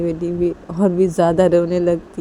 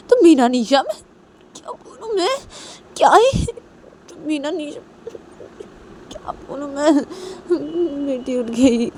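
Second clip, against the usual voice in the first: exasperated scolding; 9 semitones higher than usual. Acted. Manisha scolds Nisha for waking the baby, exasperated. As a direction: scold in a high, whining pitch.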